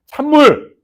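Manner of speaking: loud, high bark of cold water; sudden shout of 찬물; shouted command. Acted. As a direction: yell it like a drill order.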